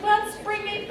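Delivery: high-pitched, sugary coaxing; imitating an over-polite note-giver; overly nice. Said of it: "they're just really overly nice to you".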